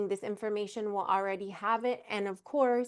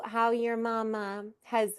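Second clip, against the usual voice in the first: ordinary delivery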